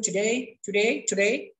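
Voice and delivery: American টুডে, ending in an "ay" sound; American pronunciation. Shown as a demonstration. Teacher demonstrating American English, টুডে ending in "ey". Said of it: "এটা American উচ্চারণ".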